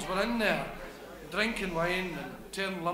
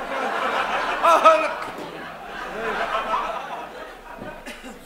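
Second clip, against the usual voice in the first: unvoiced laughing fit; breathy laughter; 4 semitones higher, 6 dB louder than usual. Real, not acted. Comic still giggling at his own joke, laughing. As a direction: laugh on without voice.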